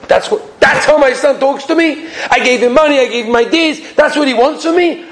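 indignant, high-pitched protest; a father's affronted outburst, pitch climbing; upset. Acted. Shopkeeper outraged at his son's demanding letter, upset. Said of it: "Then he was so upset".

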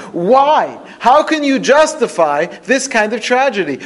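loud, high-pitched demand for an answer; impassioned challenge. Acted. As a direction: cry out in protest to God. Acting out challenge to God.

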